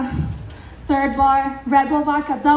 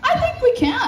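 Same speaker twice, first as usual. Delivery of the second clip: much higher-pitched, giddy agreement; retold high, tempted reply; eager agreement; 10 semitones higher than usual. Woman agrees with companion's fence plan, high and eager.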